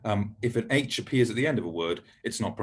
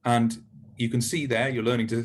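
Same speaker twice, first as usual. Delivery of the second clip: normal talking voice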